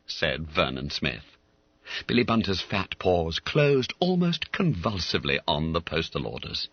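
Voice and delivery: ordinary delivery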